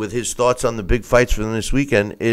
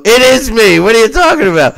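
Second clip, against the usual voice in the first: higher, louder mock outrage; mock indignant protest; 12 semitones higher, 8 dB louder, faster than usual. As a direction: act baffled and indignant. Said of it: "I go".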